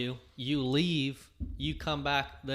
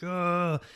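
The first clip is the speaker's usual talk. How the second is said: frustrated grunt; 6 semitones higher, 4 dB louder than usual. Retold or imitated. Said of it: "be like".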